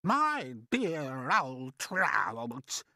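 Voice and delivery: very slow, drawn-out mocking retort; theatrical cry. Aunt putting down her vain sister, slow theatrical cry. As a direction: cry it out, stretching every word. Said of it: "Aunt Sponge cried out".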